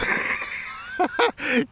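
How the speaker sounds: a very high, loud burst of laughter; shrieking laugh. Real, not acted. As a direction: cackle at his own joke. Laughs at own joke.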